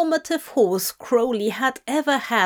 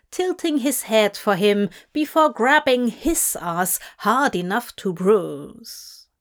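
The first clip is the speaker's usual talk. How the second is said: ordinary delivery, nothing marked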